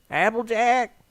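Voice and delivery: high, loud, drawn-out screech; screeching call. Acted. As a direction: screech the name from upstairs. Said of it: "Granny Smith's voice screeched out".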